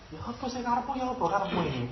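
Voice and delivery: high, fast exasperated ya apa karepmu; incredulous East Javanese complaint; baffled Javanese retort. Acted. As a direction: snap it out in disbelief.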